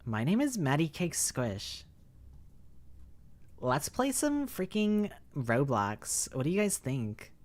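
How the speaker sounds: a hint of a bubbly valley girl streamer intro. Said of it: "Maddie sounds like a valley girl".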